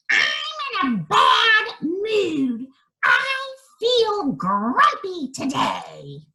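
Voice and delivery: high, slow, shouted witch voice; a cranky witch grumbling loudly; grumpy witch. Acted. Witch snapping at the woods about her mood, grumpy and loud. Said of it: "she was in a bad mood".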